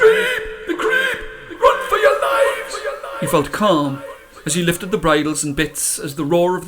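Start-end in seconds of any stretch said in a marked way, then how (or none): 0.0-2.8 very high, panicked shouts; terrified shouting. Acted. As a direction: scream the warning in terror. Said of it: "scared men yelling".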